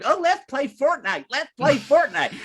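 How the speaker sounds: high whiny pleading to play Fortnite; mimicking Josh. Friend mimicking Josh begging to play Fortnite, high and whiny. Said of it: "Josh going".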